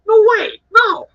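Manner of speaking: loud, high-pitched refusal; mock-outraged customer digging in, voice shooting up; enraged Karen impression. Customer refusing to hand over the sets, indignant. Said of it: "acting like an enraged Karen".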